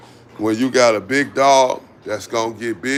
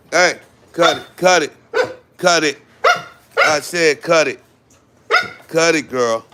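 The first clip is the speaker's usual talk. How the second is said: scolding dog; 6 semitones higher than usual. Real, not acted. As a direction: stop the dogs fighting, sharp and firm. Scolding the fighting dogs.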